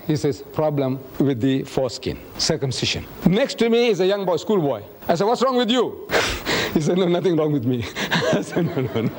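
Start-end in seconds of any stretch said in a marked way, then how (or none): none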